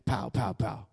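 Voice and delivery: very soft, low mimed punches; understated blows. Retold or imitated. Accuser landing blows on a believer, low quiet imitation. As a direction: drop each hit in under the breath.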